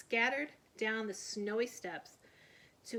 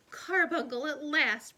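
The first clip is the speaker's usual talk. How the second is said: ordinary delivery, nothing marked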